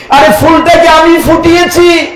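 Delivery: a sudden jeering outburst; mock boastful shout. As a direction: shout it like a proud man bragging. Mocking the proud devotee's boast.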